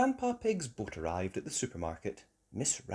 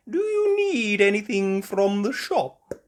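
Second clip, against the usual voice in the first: higher, louder kindly granny; Granny Pig voice; 10 semitones higher, 8 dB louder than usual. A narrator's reading. Granny Pig calling from the boat, higher kindly voice. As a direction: offer it like a helpful grandmother. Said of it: "Granny Pig asked".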